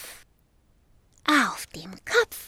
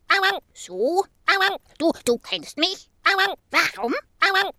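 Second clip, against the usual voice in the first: dog voice; 6 semitones higher, faster than usual. Acted. Dog Bello answers the ghost.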